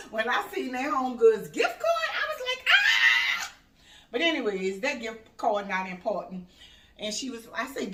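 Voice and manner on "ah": re-enacted high, loud squeal; overwhelmed scream. Mom re-enacting seeing the gift card, overwhelmed scream. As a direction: let out a piercing scream of delight.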